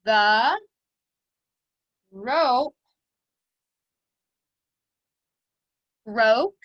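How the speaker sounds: very slow, long pauses after every word; word-by-word dictation. Shown as a demonstration. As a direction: dictate for children writing, each word isolated. Teacher dictating a sentence for writing.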